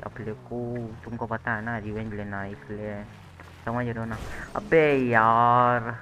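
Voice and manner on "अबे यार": loud, long-drawn groan of frustration; exasperated cry. Real, not acted. Player reacting to wrecking his beloved Ferrari, exasperated cry. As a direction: drag the words out loudly in dismay.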